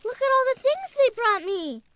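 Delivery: high, loud and eager, calling out to everyone; a child bursting with news of arrivals; excited calling out. Acted. Boy calling to his mother, excited and loud. Child greeting grandparents at door.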